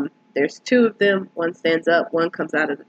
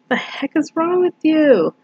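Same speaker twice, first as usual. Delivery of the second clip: high-pitched, incredulous outburst; indignant disbelief; 10 semitones higher than usual. Retold or imitated. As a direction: react like someone just screamed out of nowhere. Reacting to sudden scream in film.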